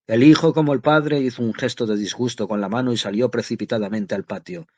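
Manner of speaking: usual speaking voice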